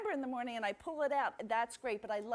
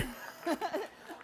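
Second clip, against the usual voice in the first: laughing; 5 semitones higher than usual. Real, not acted. Laughing at the host.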